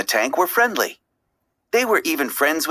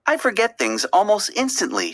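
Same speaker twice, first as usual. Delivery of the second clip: a hint of a breezy forgetful fish, pitched higher; lightly voiced Dory; 6 semitones higher than usual. A narrator's reading. Dory telling Marlin about her memory, lightly voiced and higher. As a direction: play Dory cheerfully explaining herself. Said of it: "she explained".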